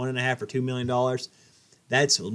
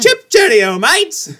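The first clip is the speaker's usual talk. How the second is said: mock English accent; 13 semitones higher, 11 dB louder, much slower than usual.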